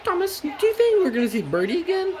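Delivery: very high falsetto coach voice; Annie and Clarabelle. Acted. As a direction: squeak it out as the little coaches.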